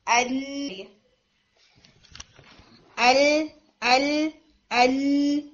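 ال with the lam held on the spread tongue front; spread lam demo. Shown as a demonstration. Teacher letting students feel the difference, ال with a held lam. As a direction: let the l in ال ring, drawn out. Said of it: "آپ اس کے اندر difference فیل کریں".

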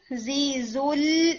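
زی زل very slow, l held; step-by-step lam. Shown as a demonstration. Teacher walking students through it, زی زل with a held lam. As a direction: stretch زی زل and rest on the final lam. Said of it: "دوبارہ ہٹ کریں گے".

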